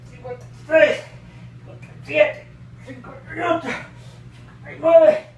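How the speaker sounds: strained, breathy counting under effort; straining. Real, not acted. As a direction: count through the burn, gasping. Counting fast reps under strain.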